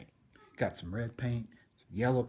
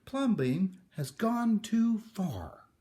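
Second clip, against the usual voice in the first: much higher, louder, slow and drawn out; outraged neighbours; 11 semitones higher, 5 dB louder, much slower than usual. Acted. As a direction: protest slowly in outrage. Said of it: "the people shouted".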